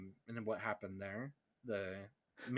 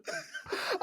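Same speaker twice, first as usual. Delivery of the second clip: squealing laugh, much higher; 5 dB louder than usual. Real, not acted. Hearing Scanlan's roast, real high squeal laugh. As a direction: burst out in a high breathy squeal.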